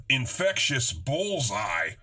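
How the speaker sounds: usual speaking voice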